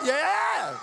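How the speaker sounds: high, loud whoop of approval; excited cheer. Acted. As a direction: cheer as if your team won. Acting out an excited congregation reaction.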